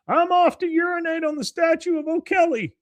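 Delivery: high, loud hammed-up announcement; acted distraction shout. Bill makes a distraction for the guards, high shout. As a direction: holler it way up high to draw attention.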